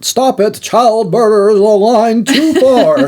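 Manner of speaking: high, drawn-out mock game voice; Deckard Cain. Joking with co-host about voice acting, hammy character impression. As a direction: imitate hammy Renaissance-fair fantasy acting. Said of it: "like, Deckard Cain, but, like, more subdued".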